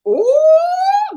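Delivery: high shocked ooh; high squeal. Real, not acted. Co-host's penis joke makes her squeal high.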